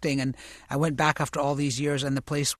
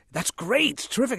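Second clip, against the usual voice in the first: higher-pitched mock director's praise; pleased director; 9 semitones higher than usual. Director praising the actor's bad accent, pleased and high-pitched. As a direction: toss out easy, approving praise.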